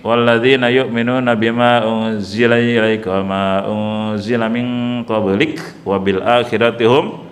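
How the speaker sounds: chanted Arabic verse reading; Quran recitation. Acted.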